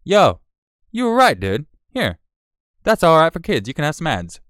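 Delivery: high, loud mock-apologetic concession; imagined video reviewer. Reviewers granting a streamer his ads back, imagined voice. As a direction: play them suddenly won over. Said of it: "wait for them to be like".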